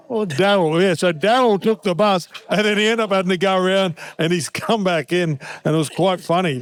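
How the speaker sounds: usual speaking voice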